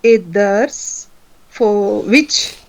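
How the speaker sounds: very slow, unsure phrasing; slow, hesitant. Real, not acted. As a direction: feel your way through the words. Student slowly working out translation.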